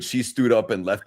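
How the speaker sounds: ordinary talk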